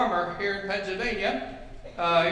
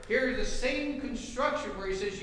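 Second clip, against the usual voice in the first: usual speaking voice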